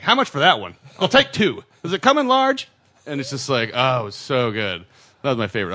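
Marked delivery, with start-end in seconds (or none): 0.0-2.7 mock eager shopper, louder and climbing higher; clueless tourist. Mocking himself as a tourist in the museum, hammed-up eager voice. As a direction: play the oblivious American buyer, each question higher.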